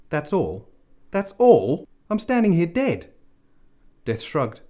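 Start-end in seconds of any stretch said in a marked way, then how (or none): 0.1-1.9 disbelief rising into a furious shout; incredulous anger. Acted. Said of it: "incredulity and anger". 2.1-3.1 high, loud furious protest; angry outburst. Acted. Dead man angry at Death.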